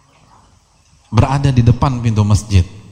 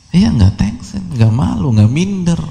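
mock cheerful voice; 8 semitones higher than usual.

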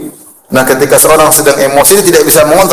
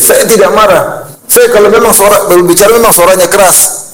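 indignant denial; 7 semitones higher than usual. Retold or imitated. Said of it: "kata dia".

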